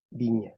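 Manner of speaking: "viña" with Spanish b-like v and palatal ñ; Spanish pronunciation. Shown as a demonstration. Teacher gives the class the Spanish word, Spanish pronunciation. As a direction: say "viña" as bee-nya. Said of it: "En español se pronuncia".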